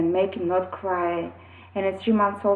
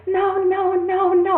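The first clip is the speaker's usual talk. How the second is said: put-on much higher, louder child's no; child refusing; 11 semitones higher, 6 dB louder, slower than usual. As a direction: squeal a little boy's protest. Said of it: "And he said".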